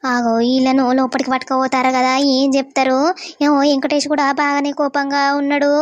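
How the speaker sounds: loud, worried muttering to herself; fretting out loud as she watches; anxious loud aside. Acted. Mother fearing for her son, loud anxious aside.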